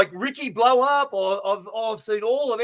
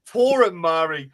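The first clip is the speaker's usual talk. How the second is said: usual speaking voice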